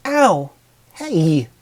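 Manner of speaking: sharp high yelp of pain, then a whiny protest; pained whine. Acted. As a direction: yelp as the stone hits your nose. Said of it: "the mare whined".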